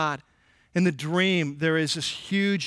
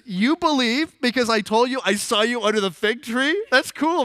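higher, louder, thrilled disbelief; bright amazed question, building up; excited; 6 semitones higher, 3 dB louder than usual. Retold or imitated. Jesus replies to Nathanael, retold.

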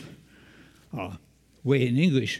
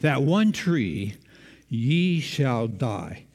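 solemn quote; slower than usual. Retold or imitated. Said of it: "they said".

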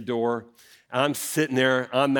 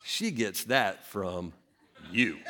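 much lower, softer, slow and knowing; wife's pointed jab; 7 semitones lower, 7 dB quieter, slower than usual. Retold or imitated. Wife telling her angry husband the daughter got it from him, low and slow. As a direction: drop it quietly like a verdict. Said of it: "you know what my wife says".

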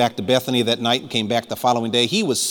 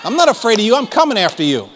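mildly raised, forceful preacher's threat; defiant challenge; 7 semitones higher, 4 dB louder than usual. Acted. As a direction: stand up to the devil. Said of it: "I tell the devil".

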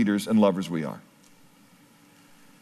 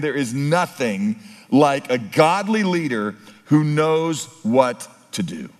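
ordinary talk

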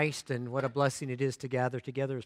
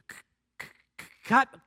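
high, stammering in terror; horrified stutter; 9 semitones higher, 5 dB louder than usual. Acted. Bamboo hearing it will be cut, horrified stammer. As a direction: trip over the word in shock. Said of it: "A trembling of great horror shook bamboo".

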